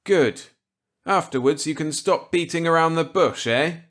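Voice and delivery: Millet's voice. Acted. Millet offering Walker a gambit, kindly. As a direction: play the genial old scientist warmly.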